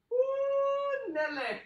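high loud "Ooh, Nelly"; whooping exclamation. Real, not acted. Coach cheering as the last hold ends, high and loud. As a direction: shout it out with relief. Relief after final hold.

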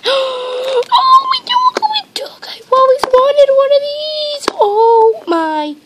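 very high, loud squeal of joy; overwhelmed excitement. Real, not acted. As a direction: squeal as if a wish just came true. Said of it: "so excited".

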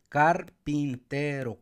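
"carpintero" slowly as car, pin, te, ro; split into syllables. Shown as a demonstration. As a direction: count out carpintero in four pieces. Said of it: "cuatro sílabas".

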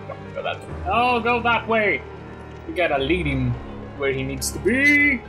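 ordinary talk

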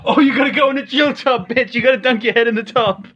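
high, loud taunting yell; gloating shout. Real, not acted. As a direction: crow it at him. Gloating at friend's forfeit.

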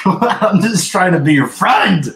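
high, loud, slow whine of a wounded friend; mock hurt helper. Helper rebuffed after a car crash, hammed-up high whine. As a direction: play the snubbed nice guy, hammed-up.

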